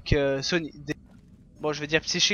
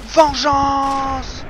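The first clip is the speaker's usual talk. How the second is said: a full-throated war cry; battle cry; 9 semitones higher, 8 dB louder than usual. Acted. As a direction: yell it high and loud. Playing a vengeful warrior in game.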